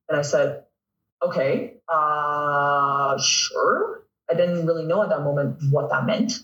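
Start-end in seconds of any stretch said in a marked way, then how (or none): 1.2-4.0 drawn-out "uh", then rising "sure"; hesitant agreement. Retold or imitated. Retells hesitant yes to coach.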